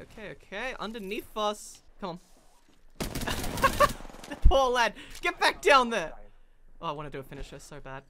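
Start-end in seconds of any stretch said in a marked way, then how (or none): 3.0-4.2 loud, high burst of laughter; gleeful laugh. Real, not acted. Player laughs at a beaten enemy, gleeful laugh. 4.3-6.1 loud mock-pitying taunt; gloating shout. Real, not acted. Player taunts a downed enemy, gloating shout.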